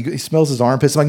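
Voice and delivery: usual speaking voice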